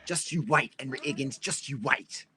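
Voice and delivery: hammed-up Cockney recitation; Cockney accent. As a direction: perform as a stage Cockney character. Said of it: "so we need the Cockney accent".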